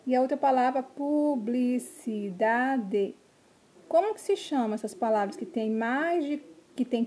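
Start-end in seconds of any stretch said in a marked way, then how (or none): 0.8-3.2 "publicidade" very slowly in five beats; each syllable of "publicidade" held, final "de" fully voiced. Shown as a demonstration. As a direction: stretch "publicidade" as pu, bli, ci, da, de. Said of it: "as palavras que possuem quatro".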